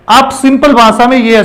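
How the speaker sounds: ordinary delivery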